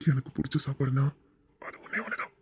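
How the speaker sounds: low, breathy romantic whisper; seductive whisper. Acted. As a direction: breathe it close to her ear. Husband's seductive whispered confession. (காதல் கிசுகிசுப்பு)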